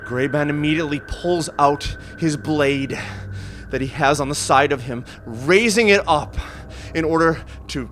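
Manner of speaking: loud, high, gripping narration; intense climax. A narrator's reading. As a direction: build to a frantic peak.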